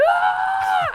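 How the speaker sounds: a sharp, very high scream. Retold or imitated. Girl finds a centipede on her stomach, very high scream. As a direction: shriek in panic at the bug. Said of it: "I'm freaking out".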